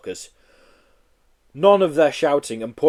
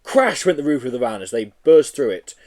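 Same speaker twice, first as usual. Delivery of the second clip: usual speaking voice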